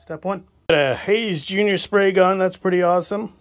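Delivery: usual speaking voice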